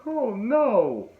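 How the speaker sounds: high, drawn-out mock dismay; retold "oh no". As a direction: wail it in exaggerated dismay. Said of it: "they actually said".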